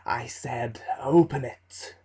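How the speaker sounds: menacing repeat. Acted. Dark figure repeats his order.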